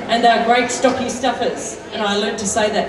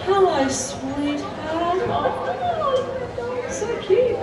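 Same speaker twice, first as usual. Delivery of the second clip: cooing at a dog; 9 semitones higher, 6 dB quieter, much slower than usual. Real, not acted. Singer coos at a puppy in the crowd, softly and high. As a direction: coo over the little dog, high and soft.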